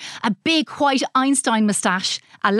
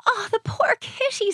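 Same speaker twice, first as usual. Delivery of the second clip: pitying; 13 semitones higher than usual. A narrator's reading. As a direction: coo it like a child to a pet. Animal lover pities the cat.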